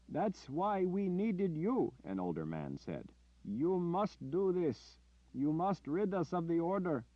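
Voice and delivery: high, slow, pleading insistence; older villager insisting. Acted. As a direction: beg him to do it. Older man insists on Richard.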